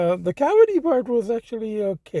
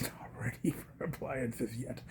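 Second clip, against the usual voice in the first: soft whispered aside; whispering; 5 semitones lower, 15 dB quieter than usual. Real, not acted. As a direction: drop to a whisper. Aside about unready kitchen.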